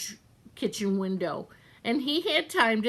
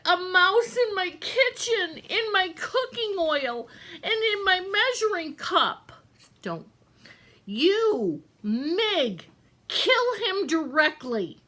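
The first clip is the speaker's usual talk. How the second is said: high, bossy cook voice in outrage; disgusted cook; 9 semitones higher than usual. Acted. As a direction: scold the maid about the mouse.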